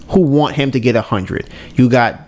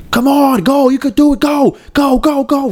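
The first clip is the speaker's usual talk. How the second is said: retold hype cheer; 13 semitones higher than usual. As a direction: chant it at him like a pushy coach in his head. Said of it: "who's always telling him".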